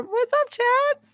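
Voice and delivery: high, drawn-out hello to a viewer; excited greeting. Real, not acted. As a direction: sing out the greeting, delighted. Greeting a viewer on her live.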